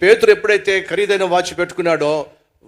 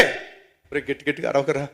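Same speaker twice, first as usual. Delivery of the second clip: soft, subdued shushing; hushed plea; 3 semitones lower, 8 dB quieter than usual. Acted. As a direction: quietly beg a friend to stop shouting. Culprit hushing the shouting friend.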